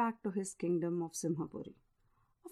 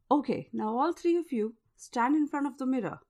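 usual speaking voice

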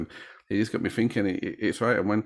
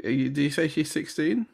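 surprised; 9 semitones higher than usual. Real, not acted. Shocked at victim's age.

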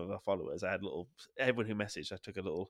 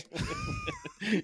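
high shrieking laugh. Real, not acted. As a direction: let out a high squeal of laughter. Laughs at his own prank.

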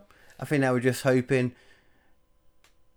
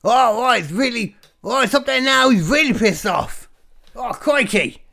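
high, loud, excited mock-Australian; hammed-up Aussie wildlife presenter in the thick of it; crocodile hunter; 9 semitones higher, 5 dB louder than usual. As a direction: exclaim in a badly faked Australian accent. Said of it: "the crocodile hunter putting his thumb up".

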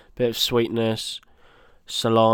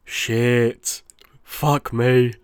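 lightly put-on celebrity chef swearing; half-hearted impression of a cursing TV chef; Gordon Ramsay; much slower than usual. Host trying a chef impression on air, mildly exasperated swearing. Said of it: "should I do a Gordon Ramsay impression?".

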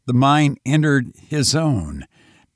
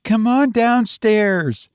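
high, slow, uneasy woman's call; wavering call; 10 semitones higher, slower than usual. A narrator's reading. Mother calls the twins downstairs, high and wavering. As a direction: call out uncertainly in a high, drawn-out voice. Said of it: "Janet called in a wavering voice".

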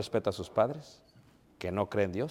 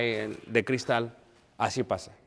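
normal talking voice

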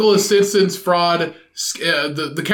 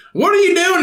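put-on high kid voice; 11 semitones higher, 3 dB louder than usual. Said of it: "kid asking dad".

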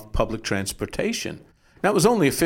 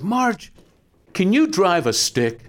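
eager call; 9 semitones higher, 3 dB louder, slower than usual. Retold or imitated. Calling out to Marge eagerly.

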